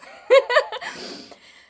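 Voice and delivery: sudden high, loud laugh at himself; laughing at his own sentimentality; embarrassed high laugh. Real, not acted. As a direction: burst into a self-mocking laugh. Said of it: "I sound like I'm on drugs".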